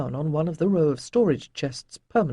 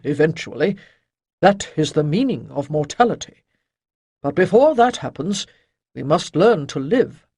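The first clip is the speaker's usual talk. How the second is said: louder, lightly voiced old master; old sage Chayden; 6 dB louder than usual. A narrator's reading. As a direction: shake off gloom with gruff cheer. Chayden gently counsels Ariel.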